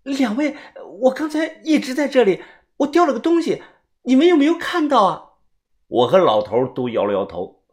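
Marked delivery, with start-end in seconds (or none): 0.0-5.3 high-pitched worried woman's voice; anxious questioning. A narrator's reading. Woman asks two strangers about a lost item, high anxious voice. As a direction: ask urgently, pitched well up. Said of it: "他着急的问".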